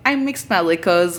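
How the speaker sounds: ordinary talk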